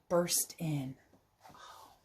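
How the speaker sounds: hushed narration whisper. A narrator's reading. Reading the story aloud, hushed whisper. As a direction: breathe it out in a whisper.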